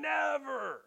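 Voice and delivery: "never" high and long drawn out; over-the-top emphasis. Acted. Preacher imitating Peter doubling down, exaggerated cry of "never". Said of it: "saying things in an over-the-top".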